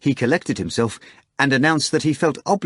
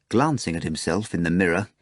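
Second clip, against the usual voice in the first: ordinary delivery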